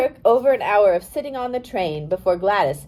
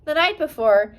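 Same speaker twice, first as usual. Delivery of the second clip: plain reading voice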